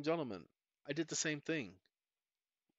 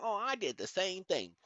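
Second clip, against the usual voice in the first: lightly voiced imitation of a know-it-all viewer; mocking a viewer; 4 semitones higher than usual. Mocking viewers who would email him, a light put-on voice. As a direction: parody the eager fan who emails in. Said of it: "do not call me talking about".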